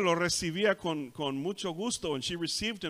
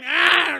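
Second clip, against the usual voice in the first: high, loud imitated toddler shriek; preacher doing a daycare scream; child's shout; 13 semitones higher, 13 dB louder than usual. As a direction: let out a piercing kid's yell.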